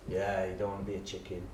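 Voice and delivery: put-on sarcastic kid aside. Boy Jack mocking the class, low and soft.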